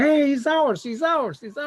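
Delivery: put-on high, loud child's cry of recognition; imitating a thrilled child, high and loud; excited kid claiming. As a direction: shout it like a proud little boy. Kid proudly claims him in street.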